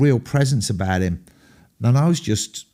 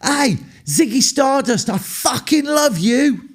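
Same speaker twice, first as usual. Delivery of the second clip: much higher, slow yelled fan call; shouted at top of voice; slower than usual. Retold or imitated. Fan yells to [name] leaving the room, slow high shout. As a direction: yell it out across the room, slow. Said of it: "So I shouted at the top of my voice".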